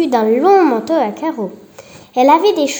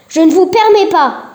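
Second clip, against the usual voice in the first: loud, higher outraged retort; indignant protest; 6 semitones higher, 6 dB louder than usual. Acted. The ex-inspector protests Marie's insult, indignant and loud. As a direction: bristle at the insult. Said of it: "s'indigna l'ex-inspecteur".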